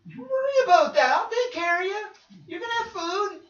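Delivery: high-pitched, worked-up parent reassurance; strained, high mock comforting; reassuring parent. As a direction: overplay a parent soothing a crying child. Parent answering the crying child.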